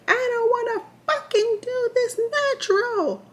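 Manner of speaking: high, strained wail building to a loud scream; retold screaming. Labouring mother screams at staff for an epidural, screaming. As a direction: scream it out, desperate, high-pitched. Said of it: "Screaming, cursing".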